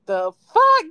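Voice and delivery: sudden high, loud blurt of shock; shocked kid. Retold or imitated. Startled kid blurting at the witch, high and loud.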